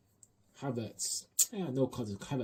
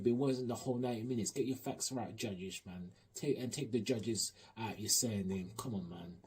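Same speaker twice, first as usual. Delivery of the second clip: usual speaking voice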